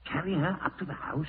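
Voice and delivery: usual speaking voice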